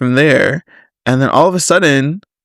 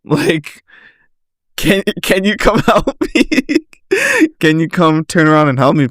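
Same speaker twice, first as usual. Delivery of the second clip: pleading through giggles; laughing plea; 3 semitones higher than usual. Retold or imitated. Calling Michelle for help after the tire exploded, giggling through the plea. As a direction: laugh helplessly while begging for help.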